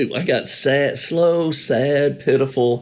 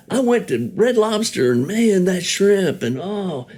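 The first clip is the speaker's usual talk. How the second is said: much higher, gushing mock voice; excited diner; 9 semitones higher than usual. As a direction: rave about the shrimp in a high put-on voice. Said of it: "if somebody will come in".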